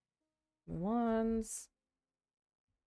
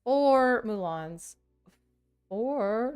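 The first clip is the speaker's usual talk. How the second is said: slow counting; 4 semitones higher, 5 dB louder, much slower than usual. Real, not acted. Counting cards aloud.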